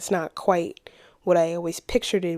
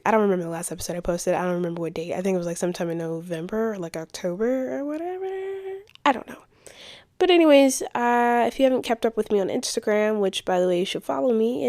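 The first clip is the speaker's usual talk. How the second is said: ordinary talk, nothing marked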